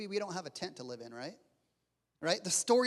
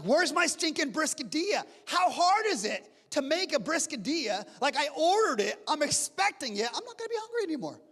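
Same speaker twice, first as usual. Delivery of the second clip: mock-angry complaint, much higher and louder; frustrated rant; 8 semitones higher, 4 dB louder than usual. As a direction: play up being impatient for the food. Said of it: "I'm like".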